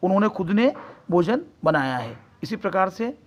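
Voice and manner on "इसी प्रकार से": normal talking voice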